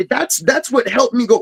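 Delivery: normal talking voice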